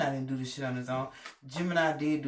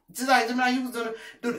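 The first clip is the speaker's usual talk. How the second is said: loud, higher cheer at Gemini; hype shout; 7 semitones higher, 8 dB louder than usual. Real, not acted. Reader hyping Gemini up, loud and excited.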